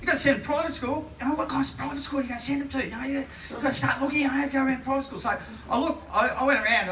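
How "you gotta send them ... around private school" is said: fast, higher, insistent nagging; hurried, higher mock parental pressure; pushy parents. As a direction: rattle it off like a know-all parent. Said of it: "everyone was going".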